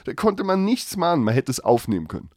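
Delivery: usual speaking voice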